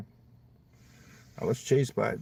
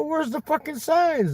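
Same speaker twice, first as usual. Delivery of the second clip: much higher mock outrage; a retold squawk of disbelief at the missing signs; indignant outburst; 14 semitones higher, 3 dB louder than usual. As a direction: demand it, half-joking. His indignant retold reply.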